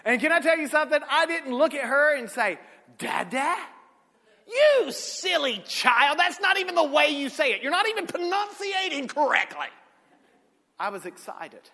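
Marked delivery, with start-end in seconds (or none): 3.0-3.6 "Dada?" rising like a doubtful question; mock questioning. Mock correcting the baby. 4.5-9.7 higher, louder pretend scolding of a baby; mock scolding.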